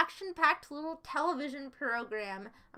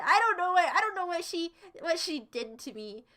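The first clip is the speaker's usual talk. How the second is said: ordinary delivery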